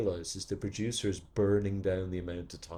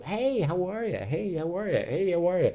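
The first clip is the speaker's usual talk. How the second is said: higher, breezy hand-shaking greeting; a hint of a glad-handing producer, higher; mock breezy producer; 7 semitones higher than usual. Producer greeting everyone in line.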